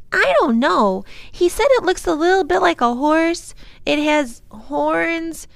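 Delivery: put-on high, loud excited child; high-pitched child, eager to explain; little girl Lindy, eager. A narrator's reading.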